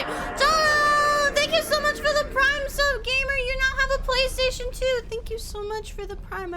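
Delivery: high put-on voice thanking a sub; squeaky mock announcer; goofy thank-you voice. Streamer thanking a Prime sub in a high silly voice.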